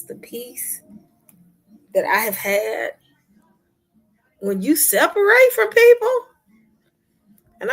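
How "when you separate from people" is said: suddenly higher and louder; emphatic. Real, not acted. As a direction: hit this line hard for the viewers. Stressing the point to viewers.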